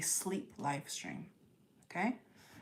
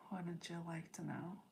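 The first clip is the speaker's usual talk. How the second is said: sly softer tease; 13 dB quieter than usual. Real, not acted. Teasing repeat, much softer.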